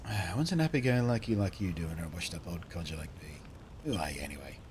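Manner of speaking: put-on old codger voice; washed-up old codger. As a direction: play a washed-up old local, sinking in pitch.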